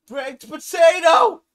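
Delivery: a loud, high cry of delight; thrilled shout at the discovery; excited shout. Real, not acted. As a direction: yell it out in sheer thrill. Discovering baked potatoes.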